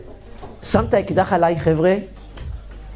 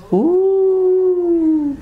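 drawn-out suspense; 8 semitones higher, 3 dB louder, much slower than usual. Acted. Long drawn comic pause.

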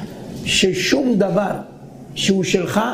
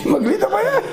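much higher, laughing through it; laughing punchline; 10 semitones higher than usual. Real, not acted. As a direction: crack up at the image.

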